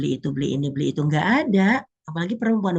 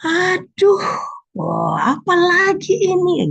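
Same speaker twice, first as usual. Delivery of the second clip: much higher, louder, slow exasperated wail; exasperated complaint; 13 semitones higher, 7 dB louder, much slower than usual. Pretend. Believer complaining at a new hardship, loud exasperated wail. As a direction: groan at yet another problem, hammed up. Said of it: "nggak ada istilah".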